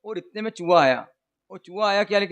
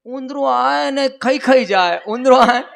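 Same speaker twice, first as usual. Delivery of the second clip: put-on higher, slow, louder lisping child voice, blurting it out; lisping girl; 4 semitones higher, 6 dB louder, much slower than usual. Preacher acts the lisping bride finally blurting out, high, slow and loud. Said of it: "वो तोतली बोलती थी बेचारी".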